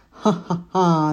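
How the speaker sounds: slow, loud wicked cackle; witch cackle. A narrator's reading. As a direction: cackle over the cauldron. Witch cackles during spell.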